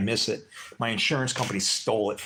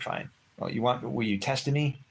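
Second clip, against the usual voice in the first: ordinary delivery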